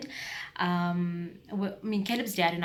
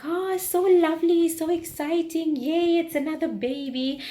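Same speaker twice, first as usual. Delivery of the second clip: much higher, louder, slow gushing; gushing family; 11 semitones higher, 7 dB louder than usual. Retold or imitated. Aunties cooing over news of a new baby, gushing and high. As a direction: squeal with delight like excited aunties. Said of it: "rest of my family was like".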